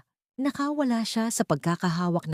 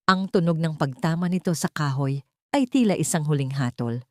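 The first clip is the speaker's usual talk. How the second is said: ordinary delivery, nothing marked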